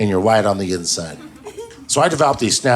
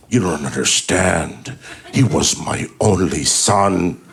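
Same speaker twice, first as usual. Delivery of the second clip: low, slow, drawn-out lament; hammed-up, low-voiced bereaved dad; grieving movie father; 7 semitones lower, slower than usual. Father on his knees after the gunfight, mock-tragic.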